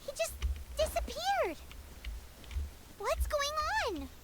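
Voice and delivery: put-on high, loud, wide-eyed kid voice; excited game character. Reading the small girl's lines to the viewers, high, loud and startled.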